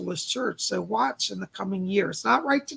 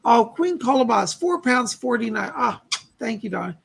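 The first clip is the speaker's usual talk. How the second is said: ordinary talk, nothing marked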